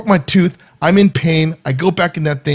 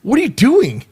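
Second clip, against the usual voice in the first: raised, alarmed wait a minute; startled, higher protest to the dentist; patient protesting to the dentist mid-extraction, raised alarm; 7 semitones higher, 3 dB louder than usual. Retold or imitated. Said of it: "It's like".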